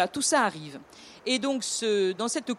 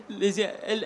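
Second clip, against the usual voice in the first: laughing. Real, not acted. Joking about women, laughing.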